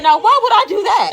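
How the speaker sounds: high, loud incredulous question; retold loud, sassy challenge; indignant retort. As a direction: throw it back, offended and high. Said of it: "And I said".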